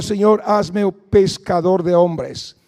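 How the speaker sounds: slow, drawn-out prayer line; modeled prayer. Shown as a demonstration. Preacher modeling a prayer for the congregation, slow. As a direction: pray it slowly for them to repeat. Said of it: "hay que pedirle al Señor".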